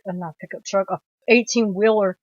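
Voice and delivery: ordinary talk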